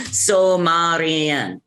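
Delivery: "Sumerian" stretched slowly into soo-MAR-ee-an; practised pronunciation. Shown as a demonstration. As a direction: draw out "Sumerian" with an open "MAR". Practising the corrected word.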